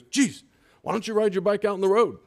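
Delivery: mock the startled walker's annoyed complaint; upset lady. Retelling the dog walker scolding him, mildly mocked. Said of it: "she's kinda upset".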